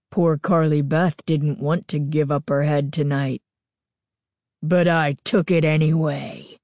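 put-on a possessed girl taunting the boys in a low rasp; creepy mask voice. A narrator's reading. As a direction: gloat over them darkly.

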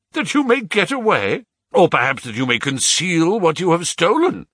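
high, accusing outburst; angry retort. A narrator's reading. Father suspects son wants to escape, angry retort. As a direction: throw the suspicion back at him.

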